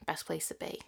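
soft whisper, confiding; whispered aside. Real, not acted. Confiding to viewers her favourite cosy spot, whispering. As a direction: breathe it like a cosy secret.

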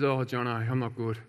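low, soft, drained voice; collapsed and spent. Retold or imitated. Rower collapsed on the floor to his mate, low spent voice. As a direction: let it sag, low and weak, as if wiped out. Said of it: "he collapses onto the floor".

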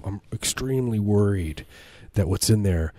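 usual speaking voice

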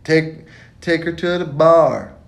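loud, slow, drawn-out order; slow loud emphatic command; emphatic shout. Real, not acted.

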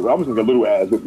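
fast, offhand resignation; shrugging rush. Retold or imitated. Kid answering the neighbor who will snitch, fast and resigned. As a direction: toss it off quickly, resigned. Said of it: "I'm like".

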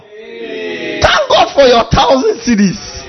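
high, slow, laughing thanks; milk the thanks for the money; mock grateful pastor. Preacher mocking a pastor thanking for a big offering, high and laughing.

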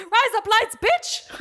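high hammed-up Australian accent. [name] on the phone, high mock Australian accent. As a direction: put on a cartoon Aussie voice. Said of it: "if you don't know an Australian accent".

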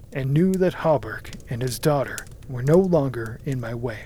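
plain reading voice

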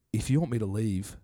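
low, soft defiant grumble; defiant bloke voice. Retold or imitated. Bloke refusing to leave the restaurant, low defiant grumble.

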